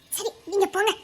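usual speaking voice

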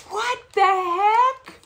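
high, drawn-out surprise; amazed. Real, not acted. Reacting to a gift of zero sugar candy, amazed.

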